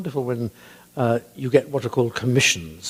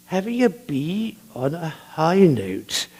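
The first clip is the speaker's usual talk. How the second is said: slow way of talking; 3 semitones higher, slower than usual. Retold or imitated. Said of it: "he had a lovely, slow way of talking".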